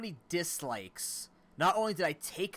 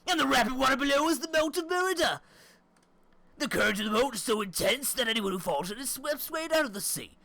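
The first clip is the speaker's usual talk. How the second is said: much higher, loud hammed-up British accent; exaggerated British voice, high and loud; 12 semitones higher, 7 dB louder than usual. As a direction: play an over-the-top British tour guide. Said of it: "Why would you want me to do a British accent?".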